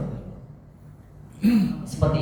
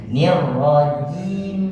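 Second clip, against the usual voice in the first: "nir" stretched long and slow, higher; drawn-out nir; 3 semitones higher, much slower than usual. Shown as a demonstration. Teacher showing the student a long "nir". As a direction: draw out "nir rajim" very slowly. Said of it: "Jangan ditekan".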